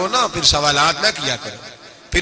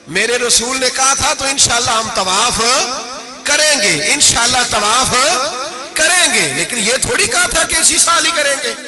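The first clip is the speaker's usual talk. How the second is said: rising, louder sermon climax; fervent preacher, building higher and louder; preaching at full force; 5 semitones higher, 4 dB louder than usual. Acted. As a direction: hammer it home to the crowd. Preacher drives the point home.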